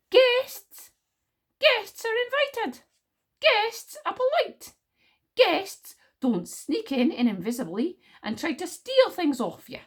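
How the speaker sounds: loud, high, slow lecturing outrage; indignant. Acted. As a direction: spit the word back, then spell out each point indignantly. Wish scornfully corrects the word guests.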